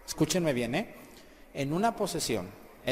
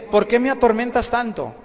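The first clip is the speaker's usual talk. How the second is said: loud, higher-pitched complaint; acted protest; 6 semitones higher, 7 dB louder than usual. Possessed man complains to Christ, raised-voice protest. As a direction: demand an answer from your tormentor.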